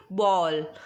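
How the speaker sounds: बॉल with a wide open rounded o; बॉल like English ball; open-mouth ball. Shown as a demonstration. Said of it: "हम पूरा मुंह खोलते हैं".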